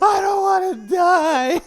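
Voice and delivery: drawn-out high, loud mock wail; ballistic panic. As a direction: imitate a terrified girl howling slowly in hysteria. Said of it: "and she goes ballistic".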